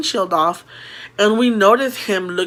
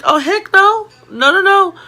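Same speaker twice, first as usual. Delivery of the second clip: high, loud panicky refusal; alarmed refusal; 12 semitones higher, 7 dB louder than usual. Retold or imitated. Girls refusing as a stranger reaches for them, alarmed. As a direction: act out recoiling in alarm.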